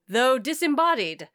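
ordinary talk

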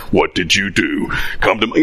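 low, menacing summons; menacing boss voice. Pretend. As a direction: read it like an angry boss. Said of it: "in that tone".

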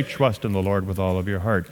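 low, soft monotone read; unemotional reading. Shown as a demonstration. Preacher showing how not to read scripture aloud, unemotional. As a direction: read it out with no emotion, low and quiet. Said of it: "you don't say".

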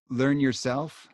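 normal talking voice

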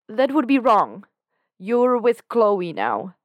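high, loud protest, slowing on the last words; loud refusal. A narrator's reading. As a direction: push back hard, nearly shouting. Dan refusing Lucifer's advance.

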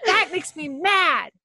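put-on high, whiny child's complaint; six-year-old arguing. Mother imitating her six-year-old son, high whiny complaint. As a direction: whine like a frustrated little boy. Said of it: "will be like".

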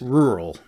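rural said carefully in two syllables, RUR-ul; own pronunciation. Shown as a demonstration. American guest shows the table his version, rural with both R's. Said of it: "I pronounce it as".

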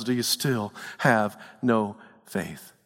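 low, soft, slow tender question; Jesus gently rebuking the fearful; gentle rebuke. Acted. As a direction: ask it like a patient, loving teacher. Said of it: "Jesus says to us".

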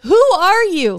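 loud, high, mock-outraged questioning; exasperated demand. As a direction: demand an answer from mystery listeners. Exasperated plea to unknown listeners.

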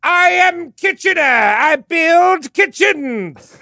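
high, slow hammed-up proclamation; pompous Kitchener. Friend voices Kitchener in a high, slow mock shout. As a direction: boom it like a silly commander.

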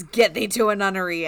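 mock-furious stage yell; mock scream. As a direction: snap it out like an offended Hamlet. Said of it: "Hamlet just looks and screams".

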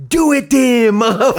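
loud high shouted taunt; shouting the old curse line back at the guy; yelling. Retold or imitated. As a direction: yell it across the street, laughing. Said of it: "I yell out".

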